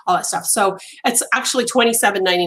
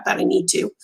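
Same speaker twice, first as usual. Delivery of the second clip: words fading as the voice goes; losing voice; 2 semitones lower than usual. Real, not acted. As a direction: voice giving out, hoarse. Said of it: "I'm losing my voice".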